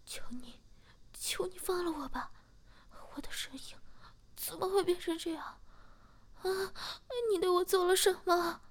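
beg, rising in panic to a cry; pleading captive voice. Acted.

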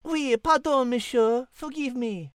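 put-on light French accent for a cat; a polite French feline, lightly accented. Cat Liz speaks.

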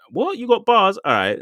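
cocky, sudden high challenge; jumping pitch on the bars question; mock grime challenge. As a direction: call him out like a grime MC. Acting out a grime clash challenge.

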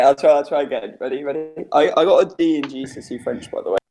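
usual speaking voice

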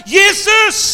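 very loud, high cry; full-force acted shout; shouted prayer. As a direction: yell the name as an emergency prayer. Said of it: "det eneste jeg roper".

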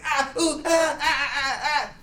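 very high yelping cries; mock pained yelps. Hurried man with sleeping legs, pained yelps. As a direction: yelp with each hurried step. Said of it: "he was like".

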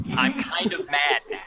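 mock annoyance through a laugh; laughing mock anger. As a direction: pretend to be mad while laughing. Laughs while claiming anger.

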